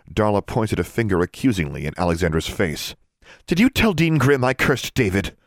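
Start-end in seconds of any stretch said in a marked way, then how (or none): 3.5-5.4 high, heated demand; angry accusation. A narrator's reading. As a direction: confront her in outrage. Darla confronts Alexandra accusingly.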